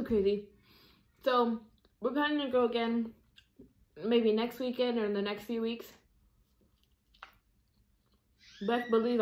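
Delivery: eating between words; talking while chewing. Real, not acted. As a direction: mouth partly full of bread. Seen 2: holds pita at mouth, bites and chews between words throughout.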